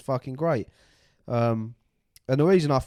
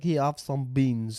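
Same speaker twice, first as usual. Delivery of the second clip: put-on Russian accent; 2 semitones higher, slower than usual. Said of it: "he's Russian".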